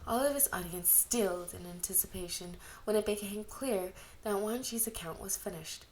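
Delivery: usual speaking voice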